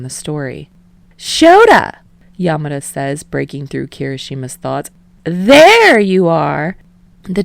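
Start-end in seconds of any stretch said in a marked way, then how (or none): 1.2-2.0 loud high hail across the room; calling out. Acted. Yamada spotting Shota across the party, loud call. 5.2-6.8 loud, slow, drawn-out hail; loud greeting. Acted. Yamada greeting Shota across the room, loud and glad.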